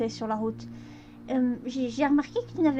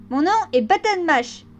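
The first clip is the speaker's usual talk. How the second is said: put-on higher, louder young colt; lightly voiced young colt; boyish colt voice; 6 semitones higher, 6 dB louder than usual. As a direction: announce your name like an eager boy. Button's voice.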